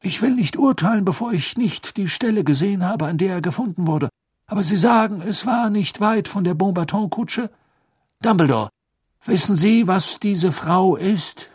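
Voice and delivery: high, loud, suspicious minister; Fudge's voice. Acted. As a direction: press the point, flustered. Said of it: "sagte Fudge".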